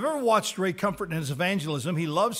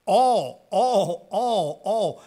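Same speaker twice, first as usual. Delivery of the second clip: "All" punched hard and loud, repeated; stress on ALL; 8 dB louder, much slower than usual. Shown as a demonstration. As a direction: hammer the word "All" each time, louder at first. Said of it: "you emphasize the word "all".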